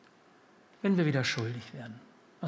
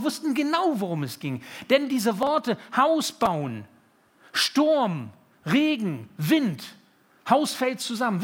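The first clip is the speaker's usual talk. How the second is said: raised, high, ringing pulpit voice; preaching at full force; 8 semitones higher, 6 dB louder than usual. Acted. Preacher warning his church of God's judgment, forceful. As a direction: proclaim judgment loudly to the congregation.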